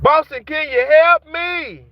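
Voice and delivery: mimicking people calling for help, hammed-up; mocking desperate plea. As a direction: whine it like flood victims begging. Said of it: "They hollering".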